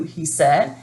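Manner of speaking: usual speaking voice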